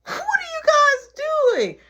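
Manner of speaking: put-on a high squeaky little boy; little brother voice. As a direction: play the nosy little kid.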